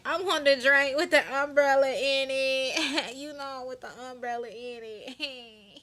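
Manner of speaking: high, giggly put-on airhead voice; ditzy woman. Woman ordering a drink from the man, mimicked ditzy and high. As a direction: mimic her high, dissolving into silly giggles. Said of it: "she's so damn stupid".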